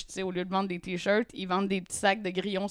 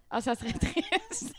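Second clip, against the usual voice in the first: stifled giggles; 7 semitones higher, 6 dB quieter, slower than usual. Real, not acted. Laughing at own joke.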